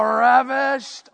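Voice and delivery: "ravished" drawn out slow and loud; sound like its meaning. Shown as a demonstration. Preacher reading a father's advice to his son, relishing "ravished". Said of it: "say it the way I say it".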